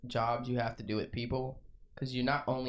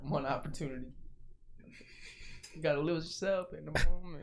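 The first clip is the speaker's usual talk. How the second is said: light rapper-style quote, barely voiced; 3 semitones higher than usual. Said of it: "he said [name]".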